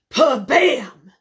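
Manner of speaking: explosive spoken bang; loud slamming-door imitation. A narrator's reading.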